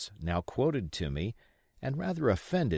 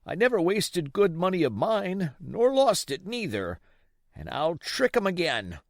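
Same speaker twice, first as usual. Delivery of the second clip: put-on a rough, coaxing old seaman, raised and loud; old pirate begging Jim for rum; old sea captain; 13 semitones higher, 6 dB louder than usual. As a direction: play the sick old buccaneer wheedling the boy.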